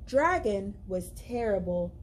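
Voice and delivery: ordinary delivery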